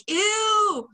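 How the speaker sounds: high, loud mock disgust; imitating her own disgusted squeal. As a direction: recoil from it with a squeal. Retold disgust at bird nest.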